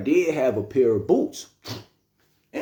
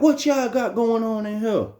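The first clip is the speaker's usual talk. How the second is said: officer's voice; 8 semitones higher than usual. Retold or imitated. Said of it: "She's like".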